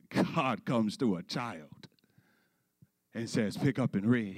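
low, soft, solemn pulpit voice; subdued, grave sermon delivery; hushed scripture reading. Real, not acted. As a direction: read it quietly and reverently, with long pauses.

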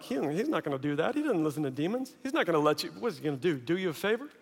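soft, quick mock murmur; doubting aside. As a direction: confide disbelief under your breath. Apostles doubting aside among themselves.

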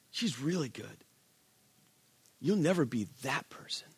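soft, low accusing murmur in the ear; a quiet devil's voice feeding doubts; whispering tempter. Acted. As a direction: breathe the lies softly like a tempter. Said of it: "He whispers in your ear".

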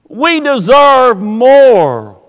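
slow, drawn-out entitled whine; mocking entitlement. Preacher mocking the tribe, slow entitled whine. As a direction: stretch it like a spoiled child. Repeated, stretched mockery.

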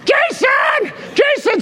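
very high strained screech, re-enacted; frantic comic yelling; yelling at a kid. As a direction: scream the name after a runaway. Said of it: "yelling at him".

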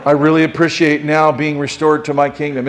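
comically understated appreciation; mock casual thanks. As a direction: thank God as if it's nothing much. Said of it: "Is that what he did?".

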